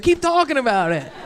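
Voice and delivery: 模仿观众起哄; heckler quote. Retold or imitated. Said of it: "That's what he said".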